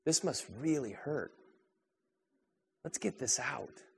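removing a speck from a friend's eye, soft and caring; gentle comfort. Pretend. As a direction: soothe a hurting friend gently. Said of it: "gently ... you say".

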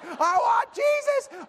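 shrieked very high and drawn out; imitated screaming. Son repeating his misheard demand, imitated screaming. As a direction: howl it like an inconsolable child. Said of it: "Over and over and over again".

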